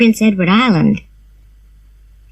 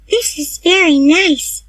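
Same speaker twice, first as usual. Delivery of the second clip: Diana's voice; 9 semitones higher than usual. A narrator's reading. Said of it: "she said".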